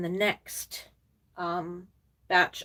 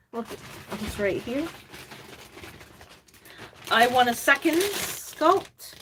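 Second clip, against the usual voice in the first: normal talking voice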